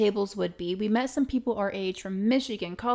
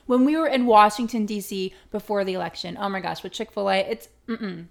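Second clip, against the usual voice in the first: ordinary talk, nothing marked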